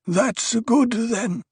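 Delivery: put-on high, slow old man asking; sudden question. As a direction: ask it abruptly, old man voice. Othheim relieved.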